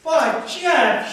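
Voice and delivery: high, loud objection; protesting. Acted. Married woman objecting to his made-up illness, protesting. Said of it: "who protested when he mentioned".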